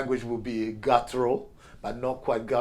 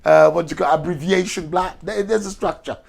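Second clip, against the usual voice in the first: Spanish guy; 5 semitones higher, 6 dB louder than usual. Acted. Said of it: "The, the Spanish guy said".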